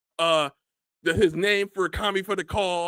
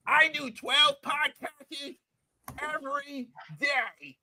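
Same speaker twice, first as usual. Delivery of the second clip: slow, drawn-out mock of his bragging; mocking his boast; 5 semitones higher, 6 dB quieter, slower than usual. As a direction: stretch it out to make fun of him.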